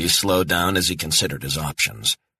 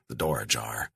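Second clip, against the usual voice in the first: ordinary talk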